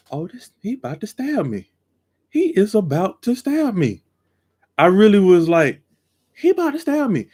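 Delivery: high-pitched alarm, climbing; panicked thought. Retold or imitated. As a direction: sound scared he's pulling a knife. Fearing the thief's knife.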